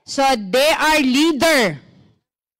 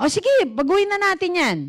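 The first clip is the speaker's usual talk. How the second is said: mock eager choleric; 8 semitones higher, faster than usual. Imitating an impulsive choleric.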